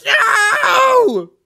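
very high, drawn-out scream; Bugs Bunny. Acted. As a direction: scream a long protest at full pitch. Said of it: "And then Bugs goes".

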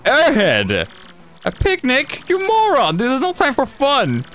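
furious villain yell. Acted. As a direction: blow up at the dumb servant. Bowser yells at Kami.

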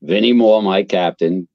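ordinary talk, nothing marked

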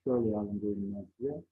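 normal talking voice

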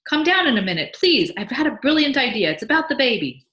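high, loud, eager call; excited calling out. Acted. Husband with brilliant idea calls wife down, excited shout. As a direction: call it up the stairs, pitched high.

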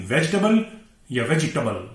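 वेजिटेबल as VEJ-tubble, then veji-tubble; correct pronunciation. Shown as a demonstration. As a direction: end वेजिटेबल in "tubble", never "table". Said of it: "टबल". (सही उच्चारण, वेजिटेबल के अंत में टबल)

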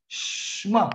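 "Shema" with a long shhh before ma; drawn-out sh demo. Shown as a demonstration. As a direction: stretch the sh in "Shema", no e after it. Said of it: "nawala yung I".

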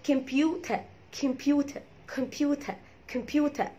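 "كمبيوتر" in British accent, crisp t and silent r, "kem-PYU-ta"; British accent computer. Shown as a demonstration. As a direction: softly model British "كمبيوتر" ending in "ta". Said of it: "بالبريتش اكسنت اسمها".

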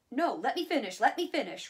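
loud, emphatic protest at being interrupted; impatient insistence. A narrator's reading. Banker refusing to let Walt interrupt, impatient and insistent.

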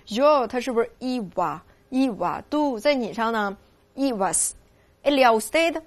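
slow drill. Shown as a demonstration. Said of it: "先把我给记住".

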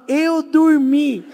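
high, very slow sheepish excuse; sheepish whimper. Retold or imitated. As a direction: drag it out, embarrassed and high. Tigas se explica. (desculpa lenta e aguda, envergonhada)

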